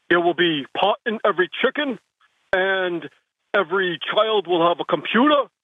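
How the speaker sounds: rally-speech Bernie, a bit of Brooklyn; Bernie Sanders impression. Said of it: "I'll do Bernie".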